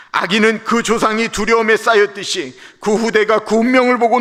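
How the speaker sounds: loud, high, forceful scolding of Job; Bildad's rebuke. Acted. Preacher voicing Bildad, scolding Job loudly. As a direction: thunder the friend's accusation.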